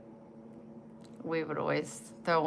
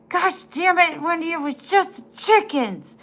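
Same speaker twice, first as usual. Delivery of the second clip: higher, louder mock angry yell; dad's yell; 11 semitones higher, 9 dB louder than usual. Imitating her dad yelling at her over chickens, exasperated.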